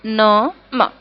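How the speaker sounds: usual speaking voice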